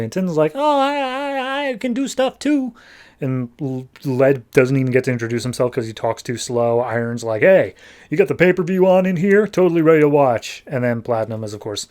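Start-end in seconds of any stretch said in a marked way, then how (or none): 0.6-2.7 high, hesitant little robot voice; bashful Tin. Retold or imitated. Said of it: "tin is bashful". 7.4-10.6 lightly voiced lunkhead, a bit louder; a hint of a dopey jock; musclehead Iron. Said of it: "like, a musclehead".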